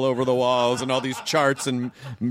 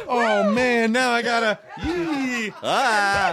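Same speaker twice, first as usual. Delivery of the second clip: higher-pitched, imitating an earnest "oh man"; mock-sincere reluctance; 7 semitones higher, much slower than usual. Friend mimicking how someone sincerely looked away, mock-sincere and high. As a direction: play awkward dread, pitched up into little squeals. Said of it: "in the most sincere".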